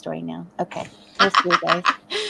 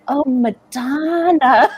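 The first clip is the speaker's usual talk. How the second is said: slow, laughing "Oh, Madonna" in plain English; drawn out, giggling as it clicks; finally understanding the French friends, laughing; laughing realisation; 5 semitones higher, much slower than usual. Retold or imitated. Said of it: "And I'm like".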